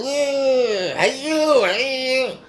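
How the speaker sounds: high, odd drawn-out call from a stranger; mock subway weirdo yelling hey you; weird stranger voice. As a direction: mimic the subway weirdo calling out. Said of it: "making some weird noise".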